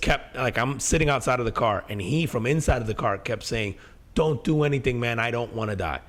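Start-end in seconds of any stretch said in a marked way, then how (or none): 4.1-6.0 a mild, understated retelling of a scared friend's plea; retold pleading. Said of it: "pleading with me".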